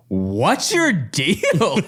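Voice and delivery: laughing retort. Retold or imitated. Retold retort, slow, laughing.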